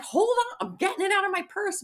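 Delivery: high-pitched retold protest; exasperated protest. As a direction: imitate yourself fending off an impatient friend. Acts out protesting at friend's demand.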